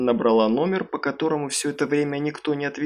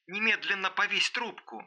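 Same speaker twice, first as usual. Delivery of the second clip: high, distant-sounding command; ordering; 7 semitones higher, 9 dB quieter than usual. A narrator's reading. Старшая женщина велит дочери повесить трубку, commanding. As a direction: order it urgently but far softer. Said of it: "приказала старшая женщина".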